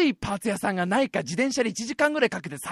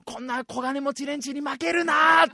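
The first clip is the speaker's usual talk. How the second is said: defiant shout; 6 semitones higher than usual. Retold or imitated. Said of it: "とか".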